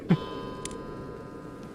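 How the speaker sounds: soft, breathy chuckle; hushed little laugh; laughter. Real, not acted. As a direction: laugh quietly to yourself. Laughs after giving clues.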